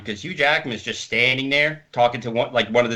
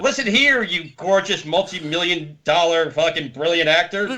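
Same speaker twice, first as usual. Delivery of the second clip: hammed-up shout, pitched much higher; mock yell; 5 semitones higher than usual. Waiter yells at a mumbling movie star, mock-furious shout. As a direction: bark it at him like an annoyed waiter, laughing through it. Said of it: "I had to basically yell at Hugh Jackman".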